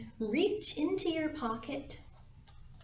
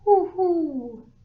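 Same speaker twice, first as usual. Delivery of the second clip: loud high owl hoots; owl hooting; 10 semitones higher, 11 dB louder than usual. Acted. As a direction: hoot like an owl.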